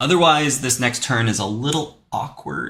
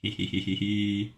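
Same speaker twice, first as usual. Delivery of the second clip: cartoon laugh; 7 dB quieter than usual. Giggles at opponent's play.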